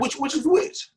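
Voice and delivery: jokey high-pitched squeak; squeaky high. Pretend. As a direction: pitch it way up for a laugh. Squeaky high take of twister.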